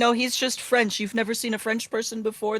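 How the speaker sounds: high-pitched, mock-indignant defence; indignant protest. Player protests angel's discrimination in character.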